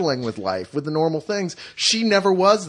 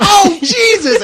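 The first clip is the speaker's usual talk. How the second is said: mock disgusted shriek; 10 dB louder, much slower than usual. Wife disgusted at husband farting.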